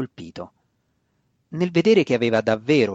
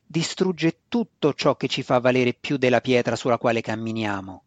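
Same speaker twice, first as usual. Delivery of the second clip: plain reading voice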